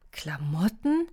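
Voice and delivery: a puzzled, rising Klamotten?; surprised question. A narrator's reading. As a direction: echo it back in disbelief. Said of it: "fragten Willa und Tiki im Duett".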